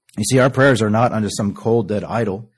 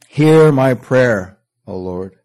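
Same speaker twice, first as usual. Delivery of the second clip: Hear drawn out very slowly and louder; slow and weighty; 3 semitones higher, 5 dB louder, much slower than usual. Acted. Preacher reciting the psalm's opening to the congregation, slow and weighty. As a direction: stretch Hear my prayer slowly.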